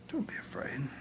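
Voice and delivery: soft whisper, barely voiced. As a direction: breathe it out gently as comfort. Jesus calms frightened disciples.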